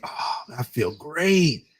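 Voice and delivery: slow, airy stoned contentment; blissfully relaxed. Retold or imitated. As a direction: sigh into it, drawing the words out. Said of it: "to relaxed".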